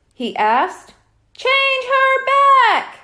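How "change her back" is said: high, loud, slow yell. A narrator's reading. Boys in the audience yell, loud and drawn out. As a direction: yell it out, dragging the words. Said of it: "Bradley and Brian yelled together".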